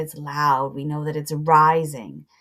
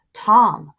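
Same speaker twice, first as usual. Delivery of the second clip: short, loud, higher shout of a name; booming call; 5 semitones higher, 9 dB louder than usual. Acted. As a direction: call the boy home in one booming burst.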